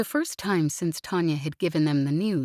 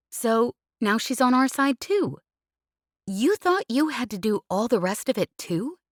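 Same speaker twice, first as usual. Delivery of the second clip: higher, teasing pilot voice, pestering her friend; cheeky pilot Jez; 7 semitones higher, 3 dB louder than usual. Acted. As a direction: needle him playfully, pitched up. Jez scolding kindly.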